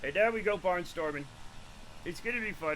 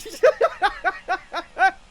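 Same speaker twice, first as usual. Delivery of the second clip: high, loud laugh; 11 semitones higher, 8 dB louder than usual. Real, not acted. Streamer laughs at being scary sexy, laughing. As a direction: crack up in a high burst. Cracking up at own joke.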